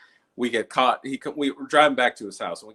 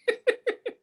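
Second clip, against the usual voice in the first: high laugh. Real, not acted. Laugh after cat scene.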